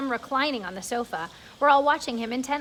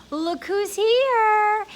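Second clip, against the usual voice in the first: mystery voice; 9 semitones higher, 6 dB louder, much slower than usual. Acted. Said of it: "he heard a voice saying".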